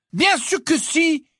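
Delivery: high, loud, slow exclamation; exclaiming. Acted. Hermione insists to Ron, loud indignant exclamation. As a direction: declare it with indignant force. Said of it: "s'exclama Hermione".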